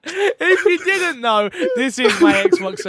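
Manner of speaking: high, loud, laughing through the words. Real, not acted. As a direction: struggle to say it through real laughter. Laughing through the show's intro.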